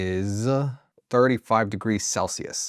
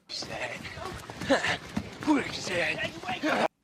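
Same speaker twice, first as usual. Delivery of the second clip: high laugh; 5 dB quieter than usual. Real, not acted. Laughing at fighting idea.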